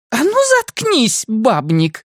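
very high, snapped command; high start falling onto the insult; furious scolding. A narrator's reading. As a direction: shut him up with a high angry cry. Woman furiously silences the man.

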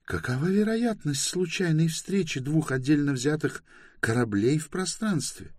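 high-pitched woman, reasoning calmly; Bayta. A narrator's reading. Bayta doubting a chance meeting in space, calm. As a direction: question it coolly in a high female voice. Голос Байты.

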